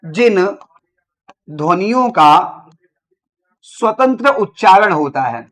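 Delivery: words spaced out with long pauses; slow dictation. Shown as a demonstration. Teacher dictating the definition of vowels, slowly. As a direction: dictate it slowly for note-taking. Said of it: "हमने कहा".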